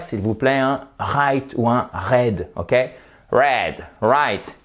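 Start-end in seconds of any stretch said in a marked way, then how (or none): 1.0-2.5 "right" and "red" with French throat Rs. Shown as a demonstration. Teacher begs learners to avoid it, French R in "right", "red". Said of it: "surtout ne pas faire". 3.3-4.6 "Red", "right" with curled American Rs. Shown as a demonstration. Teacher leads practice, American R in "Red", "right". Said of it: "Exercez-vous avec moi".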